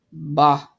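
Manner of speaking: low, loud scornful bark; Scrooge's bah. A narrator's reading. Scrooge dismissing his nephew with a low loud bah. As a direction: spit it out like a grumpy old miser. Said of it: "said Scrooge".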